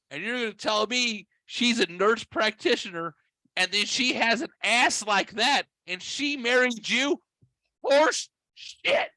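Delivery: very high, building mock outrage; incredulous rant. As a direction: rant in squeaky disbelief, peaking at the end. Retold mock rant at friend.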